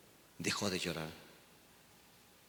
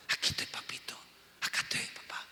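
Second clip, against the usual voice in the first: whispered "Aquí estoy, papito", tender and close; intimate whisper; 8 dB quieter, faster than usual. Shown as a demonstration. Pastor showing the church how to pray in secret, whispering. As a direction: breathe it out like a child to a father. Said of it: "Vas simplemente a conocerlo".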